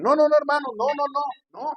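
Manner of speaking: high, loud mock protest; imitated indignant pastors. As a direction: protest like pastors scandalized by a small offering. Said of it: "los pastores dicen".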